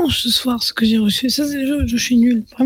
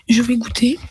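whispering; 3 semitones lower than usual. Real, not acted. About to taste, whispered.

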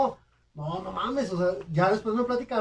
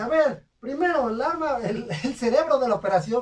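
mock stern tutor; 7 semitones higher, 4 dB louder than usual. Tutor accusing class.

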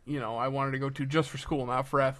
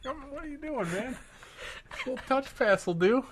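mocking voice; 10 semitones higher than usual. Player mocked in a retold play.

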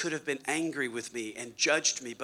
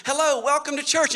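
friendly greeter; 10 semitones higher, 6 dB louder than usual. Retold or imitated. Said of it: "someone at the front door of Living Word says".

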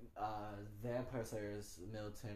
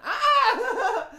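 high, loud pretend shriek; hammed-up yelp of fear, laughing; play-acted scream of panic; mock frightened scream; 21 dB louder than usual. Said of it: "act like I was just afraid".

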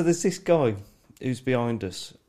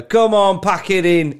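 raised, loud heckle; heckling interjection; 5 semitones higher, 7 dB louder than usual. Retold or imitated. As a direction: chip in like a commentator egging on wrestlers. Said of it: "I'd just fucking interject and go".

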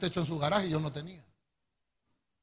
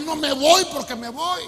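loud, slow angry insistence; furious refusal, slow and loud; angry retort; 6 semitones higher, 8 dB louder, slower than usual. Retold or imitated. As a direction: bark it back angrily. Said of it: "me puse bravo".

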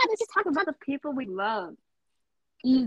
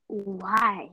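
offended why; 5 semitones lower than usual. Retold or imitated. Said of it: "she'd be like".

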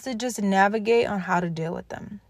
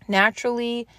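normal talking voice

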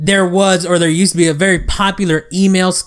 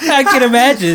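laughing; 7 semitones higher than usual. Real, not acted. Host laughs at story.

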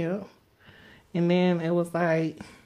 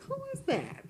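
high confused squeak; 8 dB quieter than usual. Retold or imitated. Imitating herself confused.

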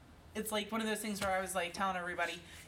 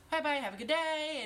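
put-on toddler voice; 7 semitones higher, 4 dB louder than usual. Mother imitates her toddler son.

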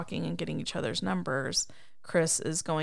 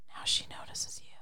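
soft whispered aside; 8 dB quieter than usual. Retold or imitated. Retelling the hashtag whisper talk scene, whispered. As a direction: lean in and breathe it quietly. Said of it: "just when he leaned in and said".

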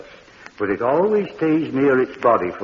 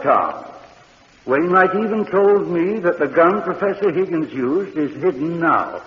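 usual speaking voice

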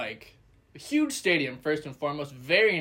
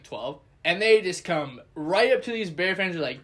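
normal talking voice

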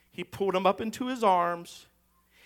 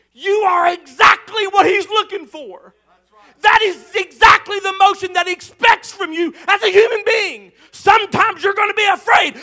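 loud, high, building sermon cry; preaching at full force; 7 semitones higher, 8 dB louder than usual. Acted. Pastor urging the congregation not to be ashamed of fear, preaching at full force. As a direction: drive it home to the church at full voice.

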